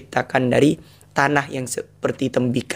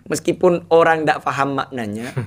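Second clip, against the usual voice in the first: ordinary talk, nothing marked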